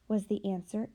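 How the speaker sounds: usual speaking voice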